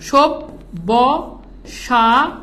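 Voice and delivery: সব্যসা- very slow, syllable by syllable; broken into syllables. Shown as a demonstration. Teacher counting syllables with students, সব্যসা- sounded out piece by piece. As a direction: stretch each piece of সব্যসা- with a clear gap after it. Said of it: "খেয়াল করেন".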